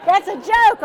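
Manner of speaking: loud, amused outburst through laughter; laughing exclamation. Real, not acted. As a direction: burst out laughing, loud and high. Cracks up after audience exchange.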